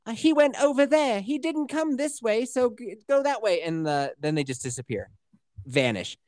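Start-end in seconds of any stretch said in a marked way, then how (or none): 0.0-3.6 high, lightly voiced little girl lying; small high kid voice pointing the wrong way; Lori voice. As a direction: pitch up into a small Harfoot child fibbing. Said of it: "Lori's like".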